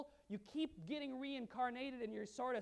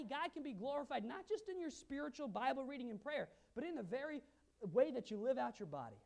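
ordinary talk, nothing marked

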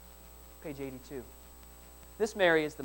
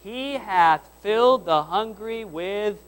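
slow, loud, raised-pitch group reading; 5 semitones higher, 10 dB louder, slower than usual. Real, not acted. Pastor leading the church in a verse together, reading out loud. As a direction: lead the congregation word by word, slowly and loudly. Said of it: "read out loud verse number fifty-three".

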